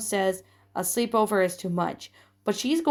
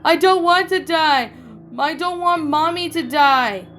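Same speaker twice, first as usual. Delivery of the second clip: put-on much higher, much louder crying child; wailing shout; 8 semitones higher, 7 dB louder than usual. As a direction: wail it out in terror. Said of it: "let out a terrible wail and shouted".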